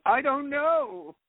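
put-on high, slow whiny valley girl. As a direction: drag out know, ditzy. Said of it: "a girlfriend who says".

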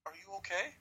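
a hint of a worried passer-by asking gently; concerned stranger. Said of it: "He goes".

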